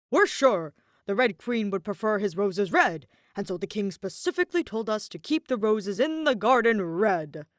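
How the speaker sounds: a keen guard explaining his orders; eager card soldier. A narrator's reading. Card soldier telling the blonde man, eager and loud.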